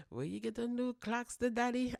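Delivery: high, excited hype voice; hype greeting. Shown as a demonstration. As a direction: hype them up loud and high. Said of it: "I need you to be like that".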